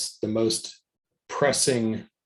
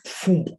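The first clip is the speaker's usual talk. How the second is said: higher-pitched "phoom" of a fireball bursting out; imitated fireball whoosh; 7 semitones higher, 4 dB louder than usual. Player describing a fireball shot, imitated whoosh. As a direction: voice the fireball's launch.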